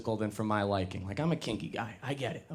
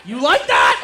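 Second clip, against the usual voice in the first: mock dominant; 11 semitones higher, 11 dB louder than usual. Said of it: "She's riding you cowgirl".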